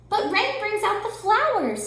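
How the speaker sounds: high, slow mouse; high little mouse voice. A narrator's reading. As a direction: savor it sweetly, high. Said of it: "said Mouse".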